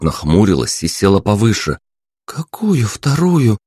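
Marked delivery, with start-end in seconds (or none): 2.3-3.6 put-on higher, slow young woman's question; a frowning girl asking; puzzled princess. A narrator's reading. Princess frowns and asks which other one. Narrator voices princess Anya.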